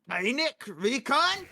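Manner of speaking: loud, high, drawn-out stab at Scottish; attempted Scottish accent. Shown as a demonstration. Caster asked to say an item name, attempted Scottish accent. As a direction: have an uncertain go at a Scottish accent. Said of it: "say that in a Scottish accent".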